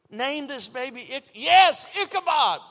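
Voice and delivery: normal talking voice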